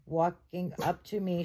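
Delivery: usual speaking voice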